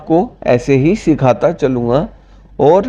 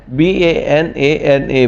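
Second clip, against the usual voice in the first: slow spelling; slower than usual. Shown as a demonstration. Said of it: "spells word letter by letter".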